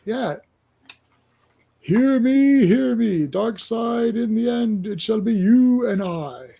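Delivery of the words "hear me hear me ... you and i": high, grand, slow villain voice; high declaiming, slowing on the last line; dark villain proclamation. Acted. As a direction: proclaim it like a cosmic overlord. Villain speech read aloud.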